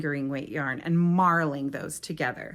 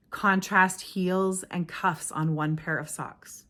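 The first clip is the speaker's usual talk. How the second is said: ordinary talk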